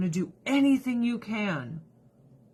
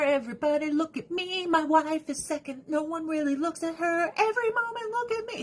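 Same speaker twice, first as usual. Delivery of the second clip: high, showy voice demanding attention; attention-hungry ex-husband; 9 semitones higher than usual. Retold or imitated. Mocking the ex-husband who wants everyone looking at him, high showy voice. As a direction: ham up a vain man craving the spotlight. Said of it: "he can shine and be the star".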